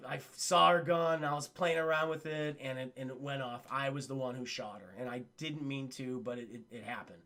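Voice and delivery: low, hushed, halting admission; subdued confession. Retold or imitated. Broadway admits he shot Elisa, subdued confession.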